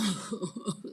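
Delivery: hooting chuckle; amused laugh. Real, not acted. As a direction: laugh at your own confession. Jokes about having demons.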